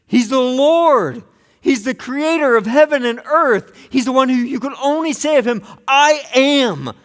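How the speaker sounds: high, loud, ringing proclamation of God's greatness; full-force preaching. Acted.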